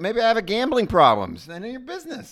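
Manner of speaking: high, defensive mock retort; indignant customer. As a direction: snap back at the nosy teller. Customer snapping back at teller.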